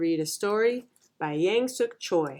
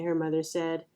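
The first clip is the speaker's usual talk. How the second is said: ordinary delivery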